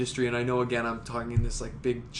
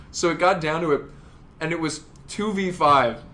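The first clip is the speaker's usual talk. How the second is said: ordinary talk, nothing marked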